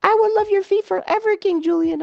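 very high, squeaky cartoon creature voice; Mort. Mocking the rescued character's devotion to his king, squeaky impression. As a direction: put on a tiny, adoring falsetto character voice. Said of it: "why Mort has a foot fetish".

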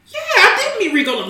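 high-pitched surprised realization; high imitating voice. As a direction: squeak it out, dawning on you.

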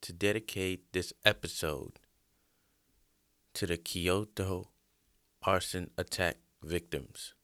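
quiet, solemn dedication. Real, not acted. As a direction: speak softly, in memory. Solemn dedication before story.

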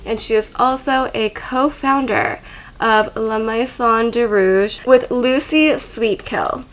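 loud, announcer-style introduction; podcast guest intro. Acted. Host introduces her dominatrix guest to listeners, announcer style. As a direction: present the guest like a show host.

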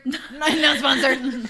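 high, loud laughing echo. Real, not acted. Echoing the joke, laughing.

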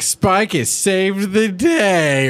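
mock triumphant entrance; heroic Spike. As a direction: declare it like a proud hero. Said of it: "He's like".

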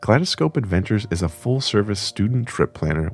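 measured, polished podcast ad read; composed announcer-style promo; sponsor read. Real, not acted. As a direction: read the sponsor copy smoothly and deliberately. Reads the podcast sponsor message.

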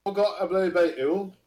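put-on a slow, half-joking British accent; mock British accent. Joining friend's joke about the UK, in a mock British accent. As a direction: drawl it like a cartoon Brit. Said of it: "I don't wanna hear a U K accent".

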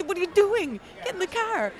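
high, alarmed call to get back in the car; alarmed friend. Retold or imitated. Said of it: "She's like".

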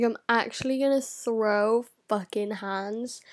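ordinary talk

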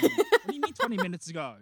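high, loud mock exasperation; acted exasperated yell; incredulous outburst. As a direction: demand it, voice shooting up. Exasperated detective in dream.

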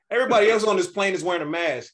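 loud, high, fast retort; loud call-out. Retold or imitated. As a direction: retell calling someone out in public. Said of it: "So I just said loudly".

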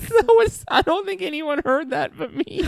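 talking through high giggles; words squeezed out laughing; laughing while talking. Real, not acted. Cracking up over fart noise.

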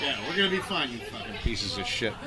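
fake-nice then a muttered insult; mock reassurance turning to a hushed curse; sarcastic mutter. Sarcastic salty sign-off to co-hosts.

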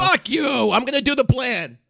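loud, high, defiant yell; angry outburst. Acted. Manager blowing up at the client, furious defiant shout. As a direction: explode at full volume.

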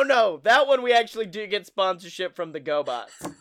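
ordinary talk

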